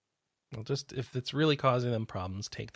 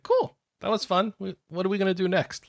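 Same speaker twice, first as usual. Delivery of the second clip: high, eager cat voice; bouncy eager pet asking for more; excited cat voice; 6 semitones higher than usual. Pretend. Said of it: "then he's like".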